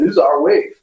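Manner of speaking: hammed-up local gangster warning; tough gangster. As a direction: bark it like a territorial surfer gangster. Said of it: "a bunch of gangsters jump you and say".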